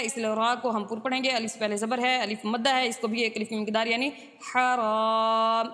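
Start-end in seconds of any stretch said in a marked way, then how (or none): 4.5-5.7 حرام with the alif stretched for one beat, very slowly; slow drawn alif in حرام; long alif. Shown as a demonstration. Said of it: "اس طرح سے پڑھنا ہے".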